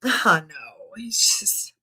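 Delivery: a tired sigh breathed into a whisper. Real, not acted. Sighing at sick child news.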